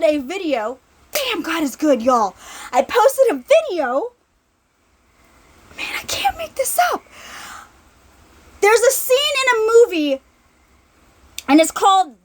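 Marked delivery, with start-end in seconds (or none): 5.8-7.0 high breathy whisper of amazement; whispered disbelief; awed whisper. Real, not acted. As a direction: let it slip out as a hushed aside. Marvelling aside to congregation.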